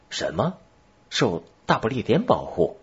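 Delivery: incredulous, rising questions; surprised exclamation. A narrator's reading. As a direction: echo it back, mildly taken aback. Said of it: "巴加内尔惊叫起来". (吃惊地反问)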